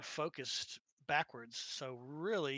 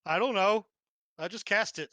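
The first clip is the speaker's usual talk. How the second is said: mock clueless player; 5 semitones higher, 10 dB louder than usual. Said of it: "I was like".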